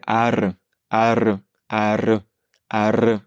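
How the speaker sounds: "ar" with a rolled r, mouth opening and closing. Shown as a demonstration. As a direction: trill the r in "ar". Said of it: "je t'invite à prononcer are en ouvrant et fermant".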